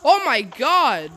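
normal talking voice

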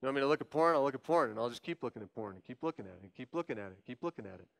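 low, quiet, resigned surrender; a hint of a defeated, dull repeat; giving in. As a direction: mumble it like someone already given over. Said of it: "You're saying".

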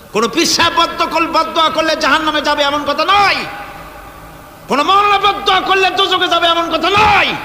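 high, insistent preacher's cry rising on the last word; forceful preaching. Real, not acted. Preacher refuting a belief to his congregation, forceful preaching. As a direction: preach it high and driving, hitting the final word.

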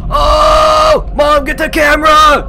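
thrilled, shouting at full volume; excited shout. Real, not acted. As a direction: yell high and loud for mom to grab the camera. Excited shout at a big jump.

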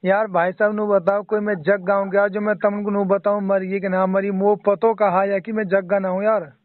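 usual speaking voice

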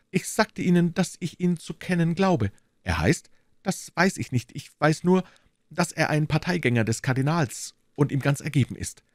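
high, fussy voice of the timid landlord; anxious little burgher. Acted. Bonacieux explaining to D'Artagnan, high and anxious. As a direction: play the nervous shopkeeper, pitched well up.

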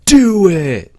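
slow, high, loud Just do it; Shia LaBeouf motivational yell. Retold or imitated. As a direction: scream like a crazed motivational speaker. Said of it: "We'll play as Shia LaBeouf".

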